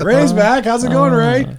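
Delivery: playful repeat of a shouted hello; shouting into mic. Shown as a demonstration. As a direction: call out the greeting at high pitch. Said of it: "when I'm gonna shout into the mic".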